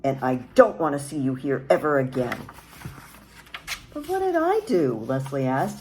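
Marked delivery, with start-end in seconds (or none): 0.0-2.4 loud stern order to leave; angry mother. A narrator's reading. Mother ordering the neighbor boy to leave, loud and angry. 3.9-4.9 put-on high, louder pleading child; a hurt little boy's protest; quivering plea. A narrator's reading. Boy pleading with the angry mother, high and upset. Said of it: "his lips quivering".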